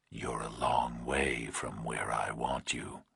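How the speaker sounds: slow, low, menacing whisper; distorted nightmare voice. A narrator's reading. Kidnapper on the phone to Burke, slow eerie whisper. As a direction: breathe it like a monster on the line.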